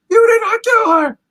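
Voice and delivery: the same plea pushed to a desperate shriek. Retold or imitated. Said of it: "he just starts telling".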